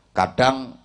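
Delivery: ordinary talk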